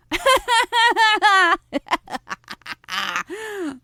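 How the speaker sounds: long, high, loud cackle; big teasing laugh. Real, not acted. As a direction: laugh long and loud at your own secret. Laughs at her own revelation.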